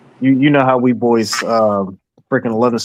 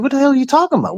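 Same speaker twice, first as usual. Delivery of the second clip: much higher, incredulous; a hint of mocking confusion; mock disbelief; 12 semitones higher, much faster than usual. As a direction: act baffled by the answer. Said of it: "everyone gonna be like".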